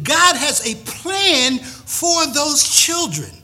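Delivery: slow, high, loud sermon proclamation; emphatic preaching. Real, not acted. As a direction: preach it with force, stretching key words.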